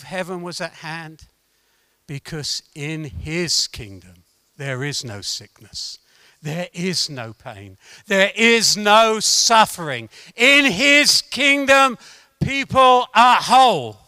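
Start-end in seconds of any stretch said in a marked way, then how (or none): 2.1-7.8 low, soft, measured assurance; subdued, deliberate pulpit delivery; hushed preaching. Real, not acted. Preacher hushes before building up. 8.1-14.0 slow, emphatic preacher's climax; building, deliberate pulpit declaration; rising proclamation. Real, not acted.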